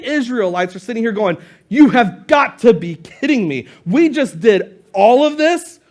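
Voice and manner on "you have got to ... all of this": emphatic, exasperated complaint rising to a question; you can't be serious; incredulous disbelief. Acted. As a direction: act out outraged disbelief, drawing out the end. Israelites' acted disbelief at the sea.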